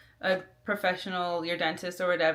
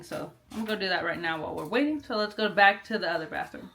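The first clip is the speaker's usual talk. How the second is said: ordinary talk, nothing marked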